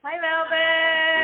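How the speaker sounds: high, loud and slow hello; drawn-out excited greeting. Real, not acted. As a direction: stretch the greeting out with delight. Greeting a guest's girlfriend.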